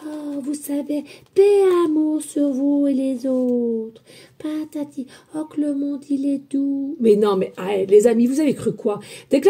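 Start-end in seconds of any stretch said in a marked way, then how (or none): 0.1-7.0 soft, slow, sugary mock spiritual voice; mock saccharine whisper of peace and love; fake sweet voice. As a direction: parody the syrupy camera-friendly healer. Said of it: "des femmes qui ont des voix douces".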